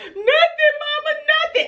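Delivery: put-on high, teary child's voice; high, tearful little girl voice; crying child denial. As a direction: wail out a denial like a scared kid. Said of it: "I said".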